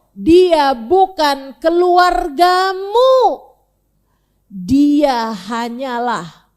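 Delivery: slow, weighty, loud divine answer; solemn recitation. Acted. Preacher recites God's reply to Noah, slow and grave. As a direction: intone each word as a verdict.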